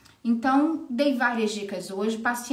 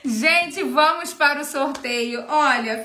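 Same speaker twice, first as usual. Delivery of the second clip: hyped giveaway announcement; 4 semitones higher, 6 dB louder, slower than usual. Acted. Starting the giveaway on live.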